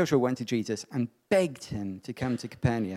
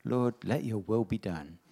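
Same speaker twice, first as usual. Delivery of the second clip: mock safe prayer; 6 semitones lower, 3 dB quieter than usual. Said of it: "So I pray".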